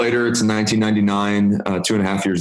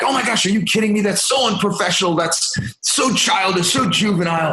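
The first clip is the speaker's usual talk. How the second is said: ugly American mode; 12 semitones higher than usual. Retold or imitated. Arrogant American boss ranting about staff staying late, mock high rant. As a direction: parody your own arrogant outrage. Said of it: "I went into ugly American mode".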